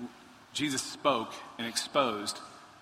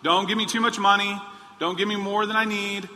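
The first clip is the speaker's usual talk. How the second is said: higher, much louder pleading prayer; mock prayer; 6 semitones higher, 6 dB louder than usual. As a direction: ham up an absurd plea to God. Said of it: "Anybody's praying".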